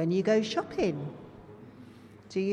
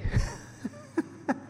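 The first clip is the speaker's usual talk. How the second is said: high breathy laugh after the punchline; airy high-pitched giggle; amused laugh. Real, not acted. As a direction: laugh lightly at the joke. Laughing after the Tube joke.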